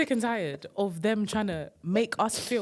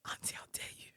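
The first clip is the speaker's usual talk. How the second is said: hushed, whispered telling-off; mock-scandalised whisper; 15 dB quieter, much faster than usual. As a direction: whisper it in mock outrage at auntie. Mock-outraged retelling of shop confrontation.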